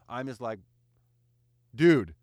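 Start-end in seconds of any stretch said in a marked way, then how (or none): 1.7-2.1 loud, punched one-word protest; exasperated outburst. Retold or imitated. Arguing back at a mask debater, loudly exasperated. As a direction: burst out loudly, as if stunned by their logic. Retelling his exasperated reply in argument.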